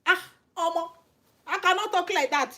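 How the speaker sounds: normal talking voice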